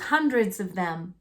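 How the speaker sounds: plain reading voice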